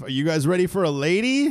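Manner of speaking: loud stand-up host intro, rising at the end. Acted. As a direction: hype up the crowd like a club MC. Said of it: "gotten that as an intro".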